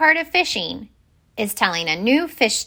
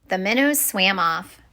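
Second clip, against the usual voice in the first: usual speaking voice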